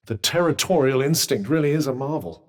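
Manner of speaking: usual speaking voice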